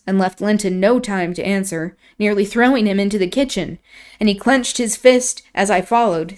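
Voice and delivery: ordinary delivery, nothing marked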